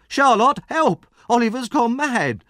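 very high, panicked yell; frantic high scream; screaming for help. Acted. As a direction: call out desperately for rescue. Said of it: "screamed Noah".

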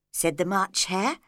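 plain reading voice

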